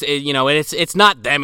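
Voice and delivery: ordinary delivery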